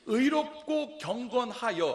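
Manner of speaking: slow, loud word by word; slow emphatic reading. Shown as a demonstration. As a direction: read each word slowly. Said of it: "보세요".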